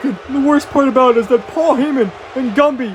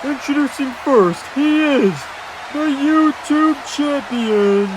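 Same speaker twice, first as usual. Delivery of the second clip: drawn-out, very slow ring introduction; ring announcer; much slower than usual. Acted. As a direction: announce the champion, stretching out the name.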